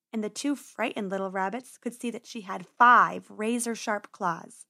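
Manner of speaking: ordinary delivery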